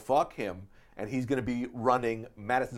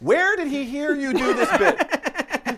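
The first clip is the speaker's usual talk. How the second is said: incredulous; 13 semitones higher, 8 dB louder, slower than usual. Retold or imitated. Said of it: "I go".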